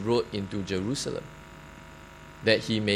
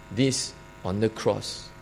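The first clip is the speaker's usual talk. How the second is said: ordinary talk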